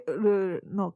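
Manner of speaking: ordinary talk, nothing marked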